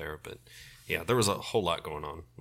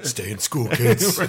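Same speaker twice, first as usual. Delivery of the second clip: mock PSA announcer; 6 semitones higher, 12 dB louder, much slower than usual. Mock public service message.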